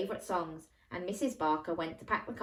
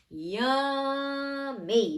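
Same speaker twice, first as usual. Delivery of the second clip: yummy; 7 semitones higher, 4 dB louder than usual. Acted. Jack rubbing tummy, character voice.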